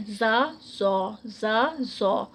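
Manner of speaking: very slow زا then زو, the first thin, the second heavy; za and zo contrast. Shown as a demonstration. Teacher warning students not to mix زا and زو. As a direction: drag out زا and زو apart. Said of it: "یہ بہت بڑی mistake ہے".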